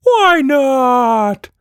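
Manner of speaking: very high, loud creature demand; a tiny monster insisting. Acted. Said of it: "the Quatch Monster cat demanded".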